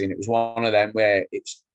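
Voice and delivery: ordinary delivery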